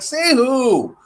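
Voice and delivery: high, incredulous retort; baffled question. Retold or imitated. Guest questioned about the ghost he saw, baffled. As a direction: ask it in mock bafflement. Retold baffled reply to hotel staff.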